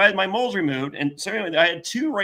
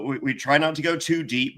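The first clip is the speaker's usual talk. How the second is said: ordinary talk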